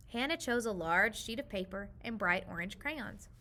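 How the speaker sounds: ordinary delivery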